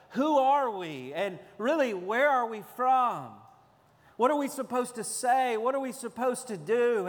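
voicing bewildered early Christians; anguished questions. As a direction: cry out as lost exiles asking who they are. Voicing confused scattered Christians.

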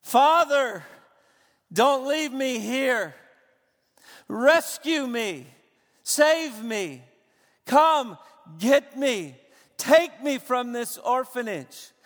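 loud, high, pleading scream to a father; desperate cry for rescue. Acted. Preacher voicing the orphan's Abba cry, loud pleading scream. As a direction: cry out like an abandoned son begging to be saved. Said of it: "It is the scream of a son for rescue".

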